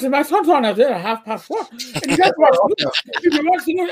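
high, garbled Donegal Irish mother; imitating an Irish mother's impenetrable accent; thick Donegal brogue. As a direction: rattle it out in near-unintelligible Donegal Irish. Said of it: "mother talked like this".

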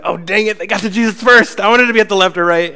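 high, loud, whiny complaint; jealous disciples. Retold or imitated. The other ten whining about James and John, high and loud. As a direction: whine like a sore loser.

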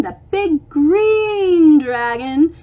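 ordinary delivery

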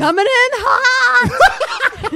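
high, very slow, stretched and laughing; mock dramatic warning. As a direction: drag it out like a cheesy action line. Enemy approaching slowly, mock warning.

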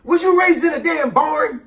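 high, loud yell from the aunt. Retold or imitated. Aunt yells the kid back inside, high and loud. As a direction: yell him back to the door. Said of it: "my aunt would yell back".

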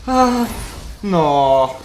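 ordinary talk

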